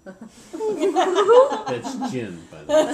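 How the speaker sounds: high loud laughing aside. Real, not acted. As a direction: laugh while explaining. Laughing aside about gin.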